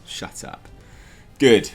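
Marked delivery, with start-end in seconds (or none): 0.1-0.7 breathy hissed "Shut up" under the breath; whispered shut up. Acted. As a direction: dismiss them in a sharp whisper. Telling off imagined critic.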